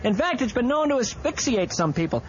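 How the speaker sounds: ordinary delivery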